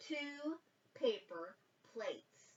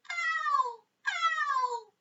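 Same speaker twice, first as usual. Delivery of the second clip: very high hooting call; owl hoot; 4 dB louder than usual. A narrator's reading. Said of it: "she called".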